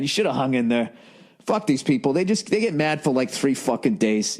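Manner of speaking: normal talking voice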